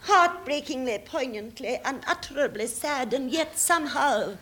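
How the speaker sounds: clipped thirties stage drama; overwrought, poignant period acting; melodramatic Coward style. Parody of Coward drama excerpt.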